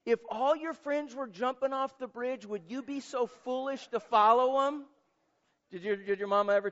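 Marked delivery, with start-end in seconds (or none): 0.0-4.8 a touch of a scolding mother, pitched higher; lightly voiced mom warning her boy; preacher imitating his mother's scolding. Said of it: "what my mom used to tell me".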